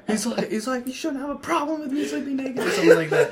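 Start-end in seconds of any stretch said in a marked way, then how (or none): 0.9-2.6 high, indignant protest; mock yelling. Naked friend defending himself, indignant mock yelling. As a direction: argue back in a high strained voice. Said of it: "while you're both yelling".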